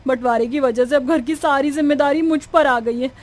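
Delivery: acted distress swelling at the end; tearful despair. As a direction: lament your sore back and mistakes, close to tears. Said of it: "Alka जोर-जोर से रोने लगती है".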